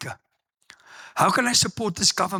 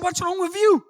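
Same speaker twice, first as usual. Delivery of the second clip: puzzled colleagues; 13 semitones higher than usual. Retold or imitated. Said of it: "They said".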